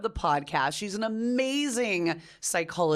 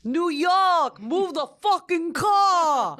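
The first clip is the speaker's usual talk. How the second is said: high, loud, slow New York accent; 6 semitones higher, 5 dB louder, much slower than usual. Shown as a demonstration. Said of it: "Do your New York again".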